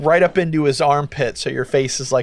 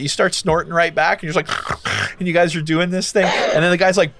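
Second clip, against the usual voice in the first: usual speaking voice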